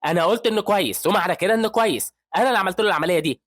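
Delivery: high, loud and fast furious outburst; angry yelling. A narrator's reading. Surgeon yelling at the resident who doubts him, loud and high. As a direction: shout him down in rage. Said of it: "بيتعصب هان وبيزعق".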